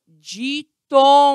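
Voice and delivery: "ditongo" loud and slow as di-ton-go; emphatic syllables. Shown as a demonstration. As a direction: stretch out each syllable of "ditongo". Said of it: "estão na mesma sílaba".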